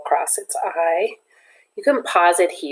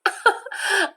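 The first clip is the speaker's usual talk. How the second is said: high, light, friendly chuckle; light laugh. Acted. Teacher laughing at Chad's question, light and high. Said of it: "She laughs".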